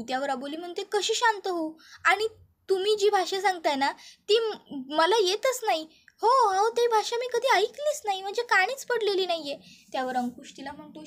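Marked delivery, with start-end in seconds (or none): 0.8-9.6 high, agitated protest; distressed young woman. A narrator's reading. Young woman protests she never heard that language, distressed. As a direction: push back hard, close to panic.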